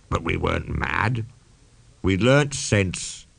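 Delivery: ordinary delivery, nothing marked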